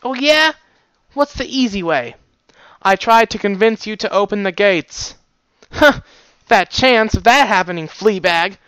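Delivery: high, loud mocking jeer; taunting guard. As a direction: jeer at the dog.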